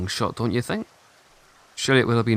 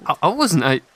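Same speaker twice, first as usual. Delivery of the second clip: stammering, higher and louder, caught off guard; flustered denial; 7 semitones higher, 9 dB louder than usual. Acted. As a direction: blurt it out, taken aback by the accusation.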